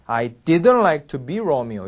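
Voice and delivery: "didn't" stressed with the T dropped, slow; silent T. Shown as a demonstration. 선생님 시범, "didn't"의 T 묵음. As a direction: land on "didn't" and drop the T. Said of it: "T가 그래서 묵음이 되죠".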